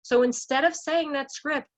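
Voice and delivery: ordinary delivery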